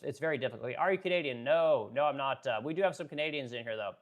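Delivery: ordinary talk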